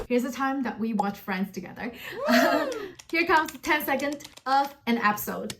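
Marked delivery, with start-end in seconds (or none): none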